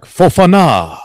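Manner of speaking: Fofana said the French way, high, stress on the last syllable, fo-fa-NA; mock commentator flourish on Fofana, fo-fa-NA with the stress at the end; French pronunciation. Mocking a commentator's French Fofana, fo-fa-NA stressed at the end. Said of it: "when Eddie Butler's like".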